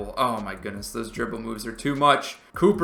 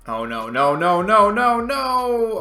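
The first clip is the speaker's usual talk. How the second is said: no after no, climbing higher and louder; panicked rising no; 8 semitones higher, 6 dB louder, slower than usual. Real, not acted. Gamer watching the opponent's shot, climbing panicked no. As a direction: beg the shot to miss, voice rising to a yelp.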